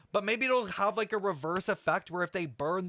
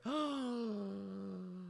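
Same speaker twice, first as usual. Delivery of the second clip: gasp; 3 semitones higher, 8 dB quieter than usual. Real, not acted. Gasps at a reveal.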